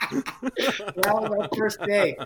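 high, loud, drawn out through laughter; real laughter carrying the words; laughing. Real, not acted. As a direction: wrap up the story while cracking up. Laughing as he ends the anecdote.

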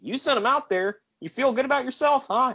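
hammed-up accusing rant. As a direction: jab the blame at him. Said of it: "He's like".

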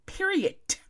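"Period" said as "periodt", ending on a hard T; emphatic high "Period" with a sharp final T. Real, not acted. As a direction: pitch "Period" high and end it on a crisp T. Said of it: "With a T".

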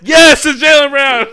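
loud, high shout cracking with laughter; laughing shout. Real, not acted. Confirming the friend's right guess, loud laughing shout. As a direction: yell it out, laughing and exasperated.

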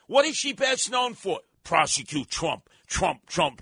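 ordinary delivery

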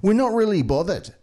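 hammed-up casual police announcement; laid-back police appeal. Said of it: "they're very open and vulnerable".

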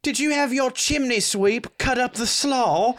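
high-pitched fancy English; hammed-up upper-class English. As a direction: ask snootily in high posh English, near laughter. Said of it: "Is this fancy English".

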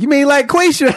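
tossing Quisha's name back at the director; mocking Quisha. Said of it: "she was like".

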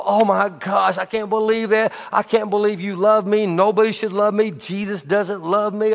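high, gushing self-pity, hammed up; mock crumbling. Preacher mocking a listener who crumbles, high mock self-pity. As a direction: imitate someone falling apart under correction. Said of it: "Don't crumble either".

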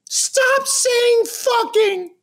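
very high, drawn-out tot voice; yelling toddler. Retold or imitated. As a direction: imitate a toddler scolding from the car seat. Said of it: "yelling at me".

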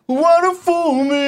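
high slow soul falsetto. Retold or imitated. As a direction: croon it like a soul ballad.

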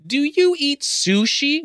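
high, slow mock-incredulous question; mocking Bruni. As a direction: mimic the smug question. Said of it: "Bruni continues".

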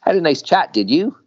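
high, loud mocking jab; teasing quip. Comet teasing Dox after catching him, high and loud. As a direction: rib him playfully.

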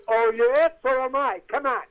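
acted guard hustling someone along; scoffing guard. Guard to man claiming he's an actor, scoffing.

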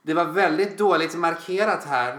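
imitated customer grumbling aloud; pointedly loud complaint. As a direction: complain for the staff to hear. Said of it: "så måste de se lite hö-, högt".

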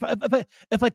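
ordinary talk

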